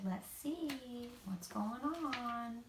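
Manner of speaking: slow, soft big bear voice; Papa Bear voice. A narrator's reading.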